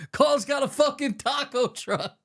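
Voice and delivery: high, drawn-out exclamation; slow, high crowing delivery; hyped-up quote. Acted. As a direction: stretch it out high in amazement. Quotes film line, laughing.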